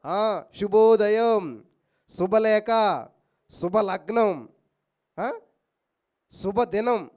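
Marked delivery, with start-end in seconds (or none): 0.1-1.6 very slow, louder శుభోదయం; slow, emphatic శుభోదయం; drawn-out greeting. Shown as a demonstration. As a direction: stretch the good-morning greeting. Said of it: "ఏంటది?".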